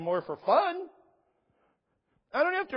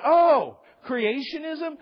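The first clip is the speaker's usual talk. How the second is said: mock surprise; 7 semitones higher, 6 dB louder, much slower than usual. Mock surprise at student's word.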